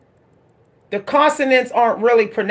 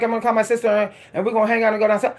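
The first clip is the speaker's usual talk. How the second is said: very fast, slurred Pittsburgh accent; mumbled, rapid-fire Pittsburgh accent; real Pittsburgh accent; 4 dB quieter, much faster than usual. Shown as a demonstration. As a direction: race through it in thick Pittsburgh speech, consonants dropped. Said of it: "The real Pittsburgh accent would sound like".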